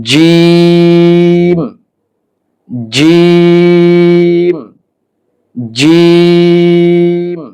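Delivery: "জিম" drawn out very long on a high, level note; mid-tongue to palate, then stretch the vowel of "জিম"; Arabic letter demonstration. Shown as a demonstration. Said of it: "তাহলে জিম উচ্চারণ হবে".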